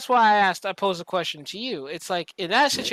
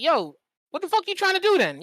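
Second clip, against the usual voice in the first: high, raised confrontational yell; retold angry outburst; 10 semitones higher than usual. Officers finally snapping back at the locals, a high retold yell. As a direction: snap back at them, voice jumping up.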